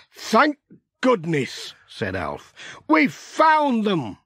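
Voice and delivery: high, slow relieved cry; relieved exclamation. Acted. Alf relieved at finding the sheep, high and slow.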